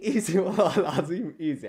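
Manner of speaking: laughing through the words. Real, not acted. Vlogger laughing at the guests, laughing. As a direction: chuckle as you say it.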